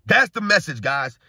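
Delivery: ordinary talk, nothing marked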